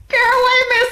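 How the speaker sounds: surprised outburst. Real, not acted. Reacting to running after car.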